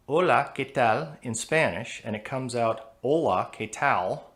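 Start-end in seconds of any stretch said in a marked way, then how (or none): 0.1-1.2 "Hola" with a light, forward Spanish L. Shown as a demonstration. Teacher comparing good Spanish with a gringo accent. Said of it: "If I say". 3.0-4.3 "Hola" with a dark American L, slowed; heavy gringo accent. Shown as a demonstration. Teacher mocking her own gringo Spanish. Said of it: "That's a heavy gringo accent".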